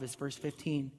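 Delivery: ordinary talk, nothing marked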